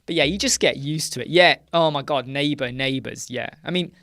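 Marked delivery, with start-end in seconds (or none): none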